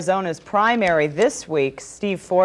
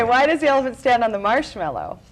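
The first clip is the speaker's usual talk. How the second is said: ordinary talk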